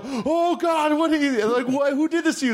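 hammed-up dismay at the dummy; shocked comic bit. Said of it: "And I go".

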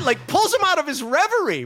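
ordinary talk

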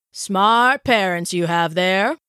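slow, drawn-out character voice; slow doctor's remark to a child; town doctor. A narrator's reading. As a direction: draw it out as an approving old-town physician. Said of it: "said Doctor Jacobs".

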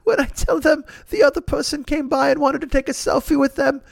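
high, wheezy-laughing English impression; John Cleese. Hero describes confused selfie seekers, high laughing impression. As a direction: squeal it through an imitated laugh. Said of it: "the Cleese laugh. He starts laughing".

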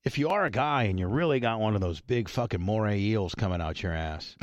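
usual speaking voice

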